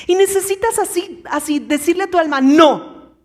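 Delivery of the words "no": a sudden, much louder no; firm no. Acted. Preacher showing how to refuse fear, loud no. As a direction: slam the door on fear. Telling your soul no.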